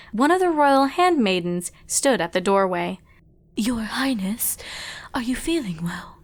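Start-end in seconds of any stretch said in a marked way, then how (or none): 3.6-6.2 soft whispered call; whispered handmaiden. Acted. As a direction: gently rouse her. Servant checking on princess.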